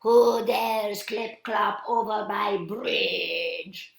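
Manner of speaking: loud, slow troll challenge; angry storybook troll; fuming troll. A narrator's reading. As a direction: boom it out indignantly, stretching the words. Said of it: "fumed the troll".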